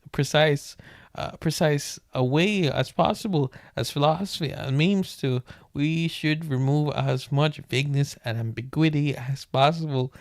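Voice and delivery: ordinary delivery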